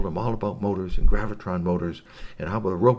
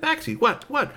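high, urgent question to dog; high-voiced puzzled owner. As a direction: pitch up, pressing the dog. Clem questions the barking dog.

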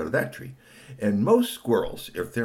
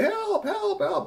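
tiny, very high squeaky plea; calling for help; much slower than usual. Acted. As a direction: squeak for help like a dangling toy. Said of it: "trying to call and say".

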